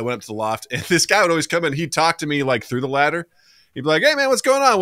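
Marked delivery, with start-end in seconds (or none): 4.0-4.8 high-pitched imitation of a chummy pal; casual buddy greeting. Dorm friend chatting through the ladder, mock-casual. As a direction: toss out a high, easy hello.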